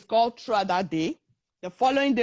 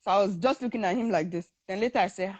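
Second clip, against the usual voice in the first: usual speaking voice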